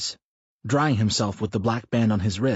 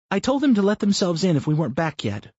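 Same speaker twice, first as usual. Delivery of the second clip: Brenda's voice; 10 semitones higher, 4 dB louder than usual. Acted. Wife teasing her husband mid-run, higher voiced. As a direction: narrator lifts into a higher, lightly voiced wife.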